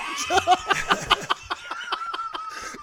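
high shrieking laughter. Real, not acted. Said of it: "you couldn't have done any worse".

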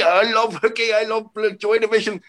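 high, far put-on voice of a drunk fan gushing. Drunk fan gushing to Caroline Aherne at the bar, high put-on voice. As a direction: gush at her like a sloppy drunk fan.

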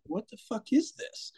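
incredulous. Retold or imitated. Laughing at absurd email.

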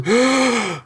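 high startled gasp; sharp gasp. Acted. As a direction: gasp as if seeing doom itself. Gnome sees Balance.

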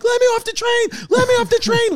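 very high-pitched wailing imitation; panicked mock plea. Storyteller mimicking kid with broken arm, high mock-panicked cry. Injured kid begging to get off.

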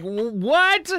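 baffled. Retold or imitated. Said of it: "you're like".

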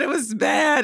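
exclaiming. Real, not acted. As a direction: belt out how bad it was. Groaning over a bad film accent.

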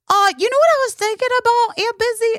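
put-on high, chatty little kid voice; little boy voice. Nephew tells his aunt what he's up to, in a high kid voice. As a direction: play a five-year-old wandering the house. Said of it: "He's like".

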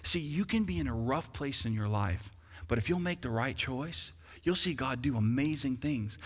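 ordinary talk

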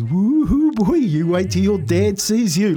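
high, slow singsong warning; warning relative. Retold or imitated. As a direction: whoop it high, mock-threatening. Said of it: "and Lucy goes".

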